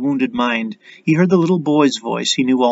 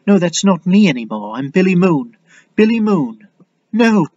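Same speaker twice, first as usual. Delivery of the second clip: higher and higher, insisting on his name; rising insistence; 6 semitones higher, 5 dB louder than usual. Acted. Billy insisting he is not that boy, rising insistently. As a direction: claim the name with growing force.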